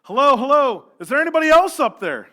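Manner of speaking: very high, loud desperate shout; comic yell. A narrator's reading. Jack refuses God's advice and yells for someone else, loud panicked shout. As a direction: bellow for anyone else up there. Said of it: "Jack let out a yell".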